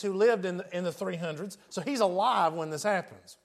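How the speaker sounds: ordinary delivery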